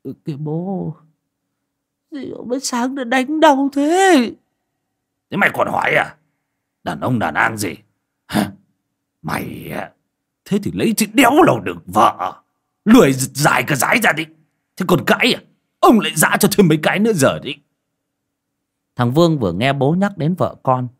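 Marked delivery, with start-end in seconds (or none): 0.0-4.3 put-on much higher, slow whining young man; whiny son. Son woken by a smack. 5.3-17.5 louder, gruff father telling off; father scolding his lazy son after the broom; angry scolding. Acted.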